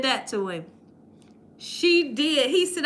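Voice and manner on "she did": ordinary talk, nothing marked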